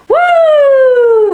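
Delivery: high, loud whoop of amazement; a sudden high, loud excited whoop; woo. Real, not acted. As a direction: let out a big excited woo. Exclaiming about growth.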